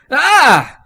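high, loud frightened yelp; little toy startled by a stranger's face, high yelp; startled squeak. Acted. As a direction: shriek in sudden fright. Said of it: "Billy squeaked".

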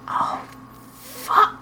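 gasped out after a blow; barely managing. A narrator's reading. Barney struck down on the sidewalk: gasping out. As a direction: strain to get the words out. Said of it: "Barney managed to say".

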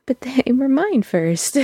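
high, slow petulant whine; drawn-out childish claim; mock possessive whine.

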